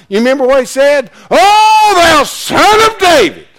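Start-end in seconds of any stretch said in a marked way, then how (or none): 1.3-3.5 loud, high, drawn-out cry; blind man crying out. Retold or imitated. Voicing the blind man calling to Jesus, loud and high. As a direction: call out like a desperate blind man. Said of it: "what the blind man said".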